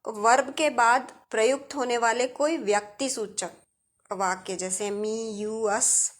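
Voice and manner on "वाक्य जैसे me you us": ordinary talk, nothing marked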